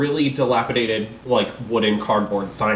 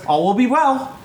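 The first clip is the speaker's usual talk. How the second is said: high Cockney shop lady; sing-song Cockney blessing; Cockney accent; 10 semitones higher than usual. Acted. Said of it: "doing this Cockney accent".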